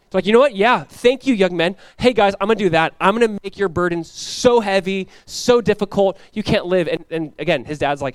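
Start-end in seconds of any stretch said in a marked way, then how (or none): 0.3-6.9 acted harsh, emphatic retort; mock-harsh reply, pitched up and forceful; harsh answer. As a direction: play a hard-hearted ruler laying down the law. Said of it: "He answered people harshly".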